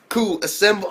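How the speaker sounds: loud, high hammed-up summons; mock rallying call. Little kid summoning his crew, mock rallying call. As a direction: call out the troops in mock command.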